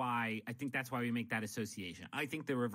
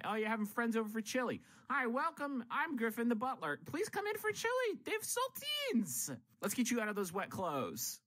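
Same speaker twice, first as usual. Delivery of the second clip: very high, sing-song butler greeting; high-pitched welcoming butler; playful butler voice; 10 semitones higher than usual. Pretend. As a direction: greet the guests like an eager butler. Griffin plays butler greeting guests.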